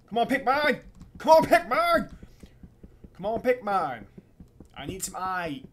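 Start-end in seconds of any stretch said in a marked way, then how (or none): 0.1-2.1 high, loud yelling at the pickaxe; shouting at the pick. Real, not acted. Gamer yelling at his pickaxe, loud and high. 3.1-4.1 louder, higher urging; louder urging at the pick. Real, not acted. Gamer urging his pickaxe on, raised.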